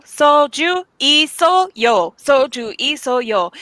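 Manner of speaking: drawn-out "soju isoyo", high and slow; slow Korean phrase. Shown as a demonstration. Teaching friends how to order soju in Korean, slow and drawn out. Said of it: "So you just say".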